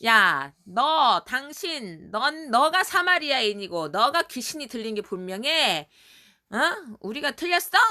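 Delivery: hammed-up outrage, with a loud drawn-out opening; acted angry accusers. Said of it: "완전 열받았겠지".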